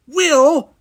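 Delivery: loud, high-pitched shout. A narrator's reading. Geordi calls Will over the badge, shouting. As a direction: shout the name in exasperation. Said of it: "shouted Geordi".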